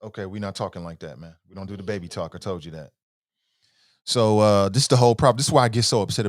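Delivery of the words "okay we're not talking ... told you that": much softer, hushed telling-off; soft gentle scolding. Real, not acted. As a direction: quietly correct the little one. Gently scolding child's baby talk.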